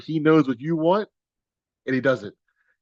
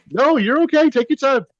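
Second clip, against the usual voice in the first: high, louder bright reassurance; reassuring; 12 semitones higher, 8 dB louder than usual. Real, not acted. Host reassures a presenter fumbling with her camera, high and friendly. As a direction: put her at ease quickly.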